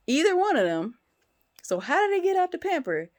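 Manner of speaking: high, loud incredulous question; exasperated and mystified; baffled disbelief. Real, not acted. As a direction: demand an answer to the impossible. Baffled by how the mess spread.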